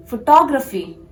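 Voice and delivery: "photography" as fuh-TAW-gruh-fee; "photography" with a strong TAW; correct photography. Shown as a demonstration. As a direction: stress the second syllable of "photography". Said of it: "focus on the second syllable".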